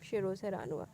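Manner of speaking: usual speaking voice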